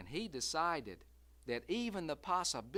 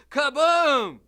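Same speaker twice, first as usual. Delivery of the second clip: much higher, loud blast word; shouted kaboom; 10 semitones higher, 20 dB louder than usual. A narrator's reading. Narrator voicing the shotgun blast, very loud. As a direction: belt it like a gunshot. The gunshot.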